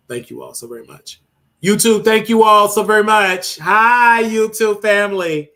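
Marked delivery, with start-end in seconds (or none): 1.6-5.5 excited. Real, not acted. Loud excited greeting to YouTube.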